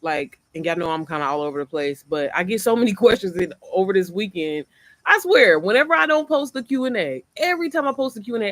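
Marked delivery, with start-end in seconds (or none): 5.1-8.5 animated venting. Real, not acted. Venting about viewer habits.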